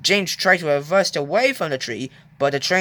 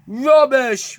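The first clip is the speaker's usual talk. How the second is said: indignant huff; loud indignant scoff; 7 semitones higher, 6 dB louder than usual. A narrator's reading. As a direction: scoff it out dismissively. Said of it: "huffed Henry".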